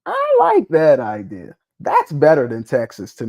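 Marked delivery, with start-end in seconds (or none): 0.0-1.6 louder, slow, stretched approval; emphatic approval. Real, not acted. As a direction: land it like you really mean it. Enthusiastic repeat of a liked idea.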